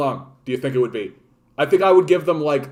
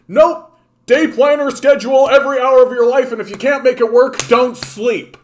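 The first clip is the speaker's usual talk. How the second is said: booming sarcastic drill-sergeant orders, much higher and louder; mock hustle coach; 10 semitones higher, 8 dB louder than usual. Mocking hustle advice to viewers, as a loud hammed-up guru. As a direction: bark the schedule like a parody grindset guru.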